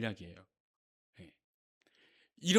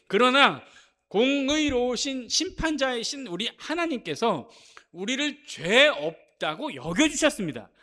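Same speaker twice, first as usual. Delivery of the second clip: raised, impassioned sermon delivery; forceful preaching; 5 semitones higher, 6 dB louder than usual. Real, not acted. Preacher reminding congregation of God's righteousness, forceful preaching. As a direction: proclaim it with conviction, pressing each phrase.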